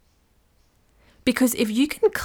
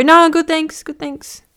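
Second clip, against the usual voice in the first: loud quick brush-off that drops to a mumble; 6 semitones higher, 7 dB louder than usual. Retold or imitated. Shopper dodging a salesperson, curt brush-off. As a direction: fob them off, then trail away. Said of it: "And you go".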